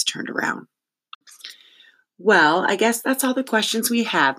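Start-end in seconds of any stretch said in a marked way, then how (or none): 2.2-4.4 high, tinkling sugary politeness. Acted. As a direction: put on a higher, tinkly woman's voice. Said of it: "in her terrible tinkle".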